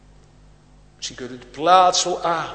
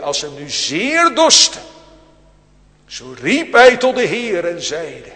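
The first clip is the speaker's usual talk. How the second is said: fervent pulpit reread of the verse, louder and higher; loud emphatic reread; 4 semitones higher, 11 dB louder than usual. Shown as a demonstration. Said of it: "Dat is een andere reden".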